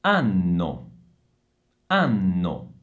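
low ANNO with a held NN; double N. Shown as a demonstration. Teacher models the double N, deliberate low ANNO. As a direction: lean on the long N in ANNO. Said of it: "bisogna pronunciare questa doppia N".

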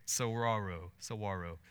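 "Saguaro" rhymed with "tomorrow". Shown as a demonstration. Preacher trying out the park's tricky name, "Saguaro" said like "tomorrow". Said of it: "Act like you're saying tomorrow".

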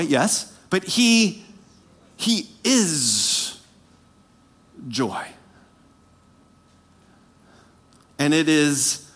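quiet preaching. Acted. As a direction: lean in and speak it gently to the congregation.

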